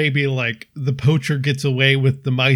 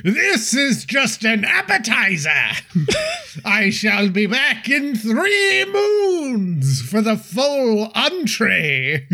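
high, slow, theatrical villain gloat; hammy villain voice; 8 semitones higher, slower than usual. Acted. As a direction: savor each word like a gourmet fiend. Friend voices the poacher.

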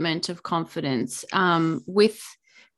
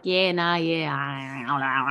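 lazy Australian mumble; 6 semitones lower, slower than usual. Retold or imitated. Guest mocking how Aussie friends talk, lazy Australian mumble. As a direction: mumble like a half-asleep Aussie with marbles in mouth. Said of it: "you're just like".

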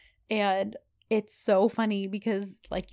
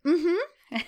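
tight, high, strained mm-hmm; through gritted teeth; 10 semitones higher, 4 dB louder than usual. Retold or imitated. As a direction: force it out through clenched teeth. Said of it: "has gritted teeth".

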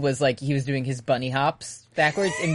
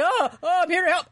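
high, mock-innocent soldier; a falsetto fake helper; faster than usual. Briggs soldier pretending to help Bradley, high and mock-innocent. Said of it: "He's like".

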